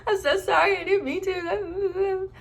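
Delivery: high mock-crying voice, drawn out at the end; hammed-up weepy pleading; imitated tearful apology. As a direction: replay a tearful confession in a high wail. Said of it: "like, tearing up".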